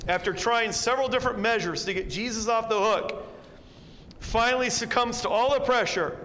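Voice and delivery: loud, high, driving sermon voice; loud, rising preaching climax; fervent preaching. Acted. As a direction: build it up, preacher hammering home the point. Preaching at full force.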